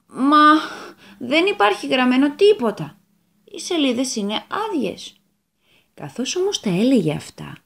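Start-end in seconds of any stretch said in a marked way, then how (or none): 0.0-5.2 a raised, louder puzzled protest; the devil insists the pages are blank; devil's protest. Acted. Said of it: "Ο διάβολος ... και είπε". (ο διάβολος διαμαρτύρεται δυνατά, απορημένος)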